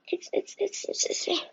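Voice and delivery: strained, failing voice; voice giving out. Real, not acted. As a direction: voice cracking away. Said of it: "I'm losing my voice from this".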